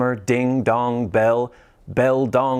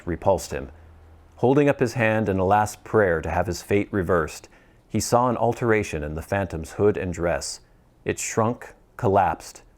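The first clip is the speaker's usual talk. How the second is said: ordinary delivery, nothing marked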